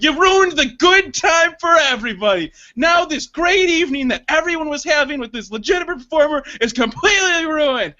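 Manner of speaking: high, shouted mock outrage; performer's rant. Retelling himself playing performer to the audience, shouted mock outrage. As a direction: declaim it to the whole theater. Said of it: "the performer at this point".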